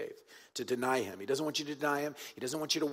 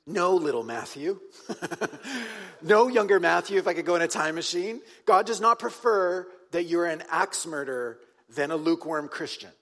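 louder address to younger self; 3 semitones higher, 6 dB louder than usual. Acted. Speaks to his younger self.

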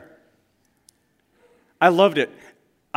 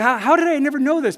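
much higher, amazed coworker; blown away; 11 semitones higher, 7 dB louder, faster than usual. Retold or imitated. Coworker blown away by the news, high amazed disbelief. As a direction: let the disbelief soar.

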